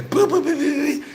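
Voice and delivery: high playful horn boops; imitated horn boops. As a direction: toot the horn back, high. Imitating own car horn.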